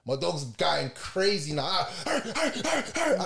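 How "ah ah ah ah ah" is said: imitated barks climbing much higher; dog barking sounds. The dog going crazy at the police, high imitated barks. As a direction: bark like a frantic dog.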